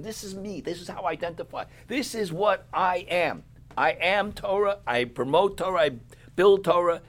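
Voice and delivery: high, rising to loud and emphatic; impassioned declaration. Acted. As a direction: voice God proclaiming His identity. Voicing God declaring His identity.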